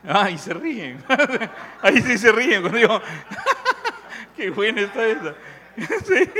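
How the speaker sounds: laughing through the words; laughing along. Real, not acted. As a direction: laughs at his own joke with the crowd. Audience laughs at his joke. (riéndose a carcajadas con el público)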